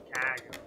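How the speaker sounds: Chicago said in a Boston accent. Shown as a demonstration. Streamer showing his Boston Chicago. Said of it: "people say that I".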